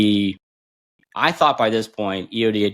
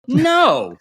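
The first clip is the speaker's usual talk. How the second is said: gleeful no; 4 dB louder than usual. Retold or imitated. Cruel reversal of fake pass.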